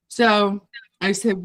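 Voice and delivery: ordinary talk, nothing marked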